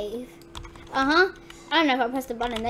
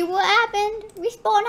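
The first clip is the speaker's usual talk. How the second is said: ordinary talk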